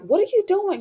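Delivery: high, shrill-pitched retold outcry of disbelief; a mock shocked shout from the group. Everybody shocked at Travis.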